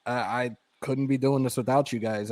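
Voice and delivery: ordinary talk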